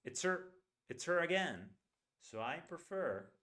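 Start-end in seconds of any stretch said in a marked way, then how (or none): 0.0-1.7 "her" said as "er" in "it's her"; reduced her. Shown as a demonstration. Teacher modelling a reduced "her" to students. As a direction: drop the h of "her" in the first phrase. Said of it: "That's the way we say it".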